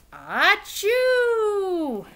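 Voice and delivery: loud, high burst of a sneeze. Acted. Bear sneezing from the pepper, loud achoo. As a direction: act out a big sneeze.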